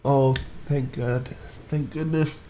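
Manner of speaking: slow, drawn-out sigh of relief; relieved. Real, not acted. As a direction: let the tension drain out slowly. Relief after ferry gets unstuck.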